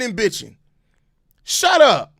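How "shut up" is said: short, high, loud outburst; real exasperated yell; angry shout. Real, not acted. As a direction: cut them off, fed up. Ends the rant at fans.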